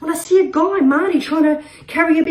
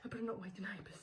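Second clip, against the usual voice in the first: much softer, lower, near silent; sudden hush; 5 semitones lower, 28 dB quieter, much faster than usual. Acted. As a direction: drop to a guilty hush. Sudden hush after screaming.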